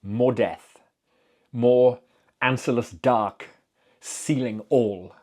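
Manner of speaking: ordinary delivery, nothing marked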